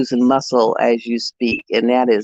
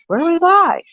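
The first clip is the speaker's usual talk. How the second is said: surprised; 4 dB louder than usual. Retold or imitated. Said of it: "I was like".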